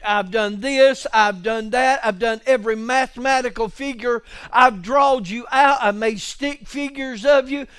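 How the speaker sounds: loud, driving sermon; loud revival preaching; full-force preaching. Acted. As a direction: keep pressing the congregation.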